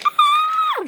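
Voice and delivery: very high piercing scream; shrill high scream. Acted. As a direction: shriek as you're caught. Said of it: "screamed Flora".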